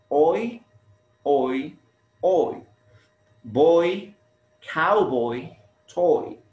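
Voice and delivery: put-on "Oi, oi, oi" punched out, then boy, cowboy, toy; isolated rhyme sound. Shown as a demonstration. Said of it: "Can you notice the similar sound?".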